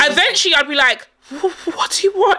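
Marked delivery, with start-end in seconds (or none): 1.3-2.4 high, slow, stammered question; mock-scared, stumbling on the first word; timid fear. Finally addressing the ghost after staring, timid. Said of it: "paralyzed by fear and I'm like".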